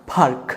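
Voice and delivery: "ফার্ক" with a puff of air on the p; aspirated P. Shown as a demonstration. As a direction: say "ফার্ক" with breathy English P. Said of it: "ফয়ের মতো উচ্চারণ হবে". ("ফার্ক" ফয়ের মতো)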